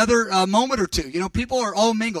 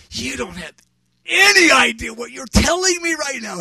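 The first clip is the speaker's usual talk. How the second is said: raised, loud, emphatic disbelief; astonished retort; 7 semitones higher, 6 dB louder than usual. Retold or imitated. Preacher answering his friend's prophecy, astonished outburst. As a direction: burst out louder and higher on ANY IDEA.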